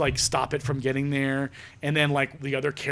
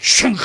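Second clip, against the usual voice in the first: high, loud imitated crunch; mock crunch; 5 semitones higher, 9 dB louder than usual. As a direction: spit out a metal-crushing sound. Imitating crushed weapons.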